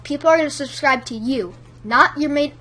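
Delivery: usual speaking voice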